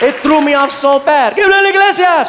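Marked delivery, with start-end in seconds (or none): 1.3-2.3 high, shouted MC call of his name; announcer shout. Retold or imitated. Announcer calling the comic to the stage, shouted. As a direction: boom the name out like a ring announcer.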